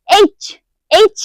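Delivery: এইচ pushed firmly from the front; forceful front articulation. Shown as a demonstration. Teacher demonstrating the letter H to learners, forceful front articulation. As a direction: give each এইচ a firm forward push. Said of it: "এইচটা আবার জোরে উচ্চারণ হচ্ছে".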